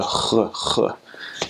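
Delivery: "ch" as a raspy French R at the back of the mouth; French R sound. Shown as a demonstration. Coach showing the hardest French sound, "ch" as back-of-mouth R. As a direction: make "ch" a throaty, rasped French R. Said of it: "The R's are probably the hardest thing".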